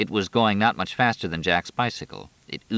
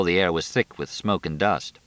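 plain reading voice

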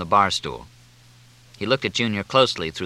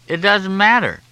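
high, louder whine; whining insistence; 11 semitones higher, 5 dB louder than usual. A narrator's reading. Junior insists to Fletcher at the bar, whining. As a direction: brush it off in a pleading whine. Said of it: "no whining voice, no quivering".

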